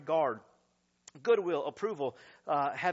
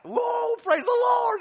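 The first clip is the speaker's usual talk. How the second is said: much higher, loud whoa; joyful shout; 10 dB louder, slower than usual. Acted. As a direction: burst out in praise.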